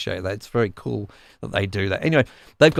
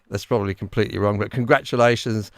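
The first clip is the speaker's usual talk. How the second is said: normal talking voice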